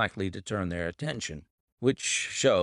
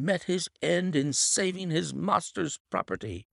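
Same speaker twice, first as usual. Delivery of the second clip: high, agitated servant reporting; distressed butler; 10 semitones higher than usual. Acted. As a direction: report the shooting in alarm. Butler explains.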